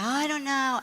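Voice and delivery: high, doubtful mock hesitation; reluctant mother. Adoptive mother answering runaway's plea, imitated reluctance. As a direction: waver in a lifted voice, imitating mom.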